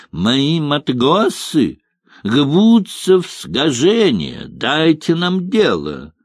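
guttural r: матгосы, гбутся, сгожение; rolling French r. A narrator's reading. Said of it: "отчаянно картавя".

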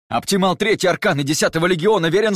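loud, high military report; blurted in one breath. A narrator's reading. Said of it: "Выпалил он на одном дыхании".

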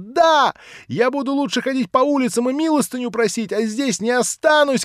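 high loud yell through tears; sobbing shout. Acted. Nelly screams through tears she won't stay. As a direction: scream it while crying. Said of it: "кричала она, рыдая".

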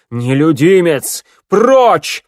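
high, loud furious command; booming warrior king. A narrator's reading. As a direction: roar the banishment. King orders shadow away. (громовой приказ короля)

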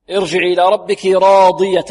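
teacherly recited demonstration; model Quran recitation. Shown as a demonstration. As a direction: recite the verse carefully for students.

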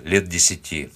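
ordinary delivery, nothing marked

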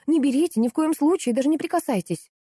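high, urgent warning; alarmed yelling. A narrator's reading. Customer warns the butcher off, yelling high. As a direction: cry out to stop someone touching it. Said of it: "заорала я".

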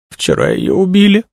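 very high crying voice; tearful. A narrator's reading. Young woman bursts out about the murder, crying. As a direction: break into a high tearful wail. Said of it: "заплакала Тришина".

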